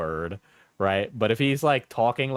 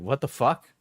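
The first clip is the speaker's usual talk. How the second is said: ordinary talk, nothing marked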